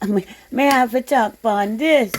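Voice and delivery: playful put-on Caribbean lilt; light mock Caribbean accent; fake Caribbean accent. Shown as a demonstration. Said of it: "my fake Caribbean accent".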